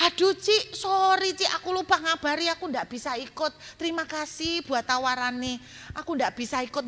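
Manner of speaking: high, sheepish apology; turning down the friend's offer; apologetic reply. Retold or imitated. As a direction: play yourself apologizing sheepishly. Own apologetic reply acted.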